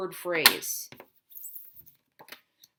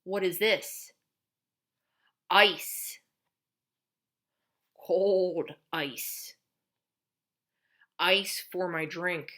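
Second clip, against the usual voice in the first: teacher modeling; 4 dB louder, much slower than usual. Shown as a demonstration. Therapist naming pictures with a child, lively. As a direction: coax a child to name things. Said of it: "Here's another one".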